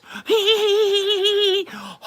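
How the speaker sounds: very high squeaky giggle; squeaky cartoon giggle. Streamer giggling in his cartoon voice for viewers.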